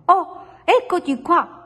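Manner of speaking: high-pitched little bird's greeting; high, chirpy cry of discovery; delighted exclamation. A narrator's reading. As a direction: exclaim high at finding someone at last. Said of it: "esclamò quando vide".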